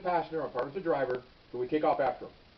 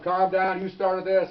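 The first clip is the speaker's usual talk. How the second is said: louder, higher command to calm down; retold stern shout; 5 semitones higher, 10 dB louder, much slower than usual. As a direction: bark it over his screaming. Officer orders screaming suspect.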